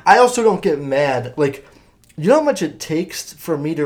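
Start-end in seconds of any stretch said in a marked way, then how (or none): none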